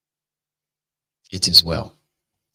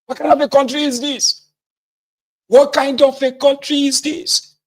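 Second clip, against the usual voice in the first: much higher, loud exasperated cry; angry exasperation; 14 semitones higher, 5 dB louder than usual. Acted. A fed-up citizen shouting at his country, outraged. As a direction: throw the question up in pure rage. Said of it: "really angry and say".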